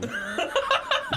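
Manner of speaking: high squeaky laugh. Real, not acted. Laughs at own joke.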